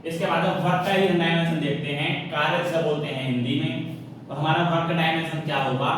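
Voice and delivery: usual speaking voice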